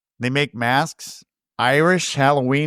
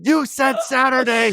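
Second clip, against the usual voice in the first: higher, pointed accusation; accusing wife; 8 semitones higher than usual. Retold or imitated. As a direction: needle him like a suspicious wife.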